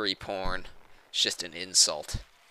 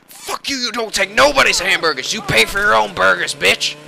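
loud, high rant; mock-angry yelling; 10 semitones higher, 10 dB louder than usual. Player scolding burger-stealing hobo, outraged yelling. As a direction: yell it in hammed-up outrage. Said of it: "'cause I'm yelling and shit".

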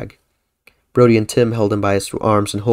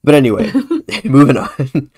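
laughing; 5 semitones higher, 5 dB louder than usual. Real, not acted. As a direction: giggle through it and move on. Laughing off the joke.

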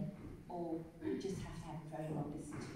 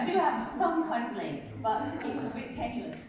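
usual speaking voice

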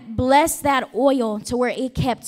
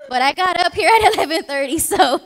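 chuckling through the words, a bit louder and higher; laughing; 5 semitones higher, 5 dB louder than usual. Real, not acted. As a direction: laugh it off while talking. Joke about being bribed with lunch.

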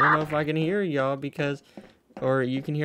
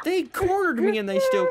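gloating taunt; 4 dB louder than usual. Pretend. Gloating at cops.

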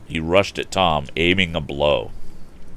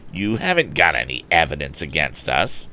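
sneering. A narrator's reading. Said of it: "sneered Andy confidently".

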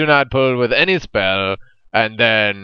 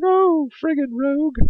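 much higher, squeaky annoyed gripe; mock whiny gripe. Player blinded by a rogue, squeaky gripe. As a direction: whine it in a tiny cartoon voice.

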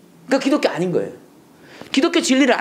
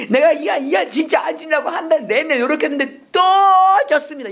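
mock whiny prayer; 9 semitones higher than usual. Mocked self-pitying repentance prayer.

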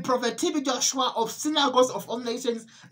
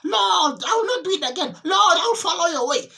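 high, loud, desperate crying out to the Lord; loud anguished wailing, pleading not to be punished; wailing plea for mercy; 6 semitones higher, 6 dB louder than usual. Retold or imitated. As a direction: beg for mercy at the top of your voice. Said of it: "they would cry and they would say".

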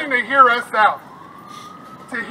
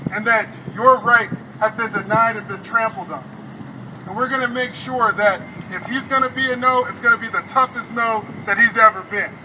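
normal talking voice

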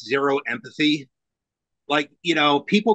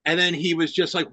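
ordinary talk

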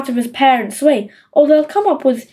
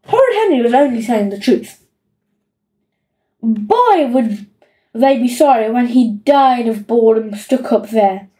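plain reading voice

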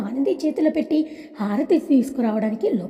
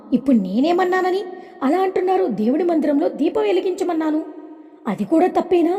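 higher, pouting protest; pouting indignation; 6 semitones higher than usual. A narrator's reading. Mother-in-law to her husband, pouting indignant retort. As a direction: push back with a sulky, pitched-up pout. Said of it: "మూతి విరుస్తూ".

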